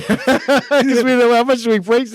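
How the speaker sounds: giggling at his own joke; laughing about the fake menu prices. Real, not acted.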